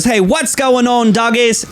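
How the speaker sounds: put-on high, upbeat Australian accent; cheerful Australian accent; Aussie greeting. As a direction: call out like a sunny Aussie vlogger. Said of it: "he goes".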